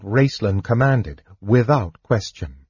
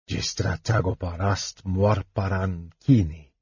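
murmured spell; 2 semitones lower, 5 dB quieter than usual. A narrator's reading. Mage casting a spell on the sack, softly murmured. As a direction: murmur the magic words to the bag. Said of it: "he murmured".

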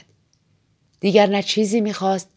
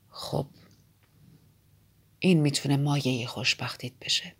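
reflective count; 5 semitones lower, 3 dB quieter than usual. A narrator's reading. Count answering Basil about death, subdued and low. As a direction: let the ends of phrases sink very soft.

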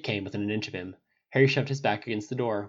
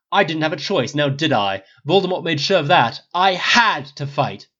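insistent, slightly raised protest; challenging; 5 semitones higher, 10 dB louder than usual. A narrator's reading. Harry telling Snape he had to fight, challenging. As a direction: push back, leaning hard on the end. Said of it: "Harry challenged".

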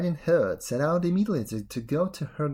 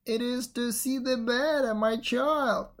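raised voice of a wolf playing granny; disguised wolf; 8 semitones higher than usual. A narrator's reading. Wolf as grandmother reassuring girl, raised false voice. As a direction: answer high and evenly, posing as grandmother.

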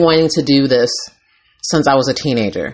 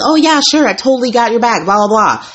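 mocking fake agreement; 8 semitones higher than usual. Mimicking people who promise help then flake, mocking sing-song. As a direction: toss off the empty promise in a mocking higher voice. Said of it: "or you be like".

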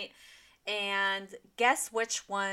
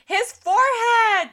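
high, slow, loud mock shout; exaggerated drawn-out exclamation; mimicked fangirl outburst; 12 semitones higher, 10 dB louder, slower than usual. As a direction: cry it out like an overawed friend. Mimics friend's outburst about idol.

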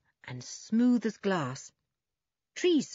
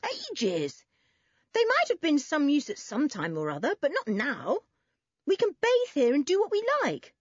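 higher-pitched, insistent annoyance; cross; 9 semitones higher than usual. A narrator's reading. Boy insisting they can bathe here, cross. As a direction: argue it crossly, rising higher at the end. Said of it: "sounding cross".

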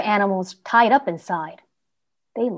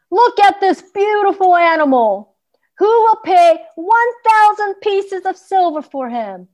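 mocking showman; 10 semitones higher, 7 dB louder than usual. Horse trader mocks donkey to crowd.

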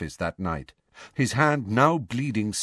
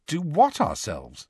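suspicious; 7 semitones higher than usual. Acted. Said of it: "said Harry suspiciously".

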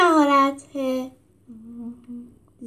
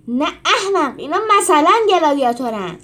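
irritated retort; 5 semitones higher, 8 dB louder than usual. A narrator's reading. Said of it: "چاکی شد".

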